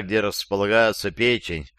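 ordinary delivery, nothing marked

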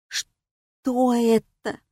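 slow, frightened whisper; horrified whisper. A narrator's reading. Girl sees the glow under her sweater, horrified whisper. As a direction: whisper it drawn out, in horror. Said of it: "в ужасе прошептала я".

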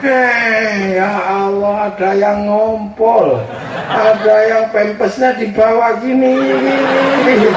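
normal talking voice

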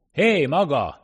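loud, high hail; sharp shout. A narrator's reading. Foreman challenging a man who lagged behind, shouting. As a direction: shout to stop a straggler. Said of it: "kiáltott rá a munkavezető".

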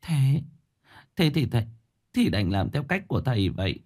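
high, halting tearful surrender; sniffling resignation. A narrator's reading. Mrs Dung yields to the master's method, tearful and halting. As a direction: give in haltingly, on the edge of tears. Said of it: "Bà Dung sụt sùi".

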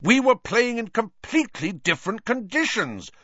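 very high, strained shout; high-pitched, worked-up yell. A narrator's reading. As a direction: shout back in exasperation. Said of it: "Wood shouted".

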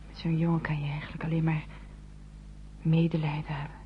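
usual speaking voice

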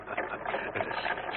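breathy pained laugh. Acted. Wounded man laughs at message.

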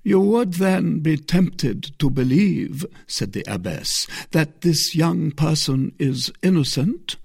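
a hint of a probing mother superior; the abbess. A narrator's reading. Narrator voices the abbess.

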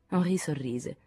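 plain reading voice

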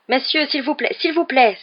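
high, loud plea for order; exclaiming. A narrator's reading. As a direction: implore them urgently, pitched high. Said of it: "s'exclama un vendeur". (supplication exclamée)

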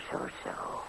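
whispered zo zo. Acted. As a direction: breathe it out slyly, almost unheard. Mocking the master's gloating. (gefluisterd, sluw "zo, zo")

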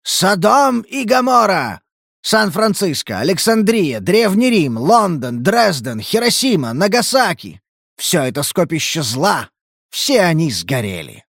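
high, slow, yelled doomsday list; wild unhinged ranting. Acted. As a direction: cry out like a crazed prophet. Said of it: "завопил".

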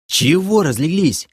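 high, strained rebuke; straining, groaning reproach; grunting with effort. A narrator's reading. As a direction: grunt it out while heaving yourself up. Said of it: "прокряхтел я".

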